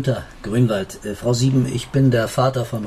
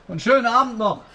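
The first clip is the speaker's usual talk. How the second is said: mocking farewell; 8 semitones higher than usual. Father mocks teacher leaving.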